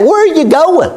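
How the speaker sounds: high-pitched mock road-rage yell. Driver at someone pulling out in front, mock road rage. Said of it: "get this up road rage and get mad".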